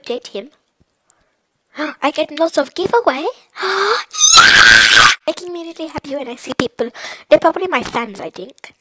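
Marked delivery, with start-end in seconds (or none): 3.6-5.1 a real burst of joy; excited yell. Real, not acted. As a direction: cheer it out. Excited over giveaways.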